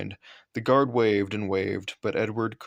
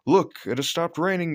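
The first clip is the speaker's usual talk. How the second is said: high, louder coaxing call; 8 semitones higher, 4 dB louder than usual. A narrator's reading. Drivers coaxing Henry out of the tunnel, high and persuasive. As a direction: coax the stubborn engine. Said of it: "they said".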